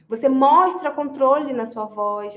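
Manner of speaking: usual speaking voice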